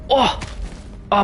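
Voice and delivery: usual speaking voice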